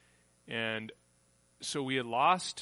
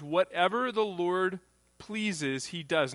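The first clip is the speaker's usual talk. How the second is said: slow emphatic reading; 5 semitones higher, 4 dB louder, slower than usual. Acted. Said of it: "Not he attempts".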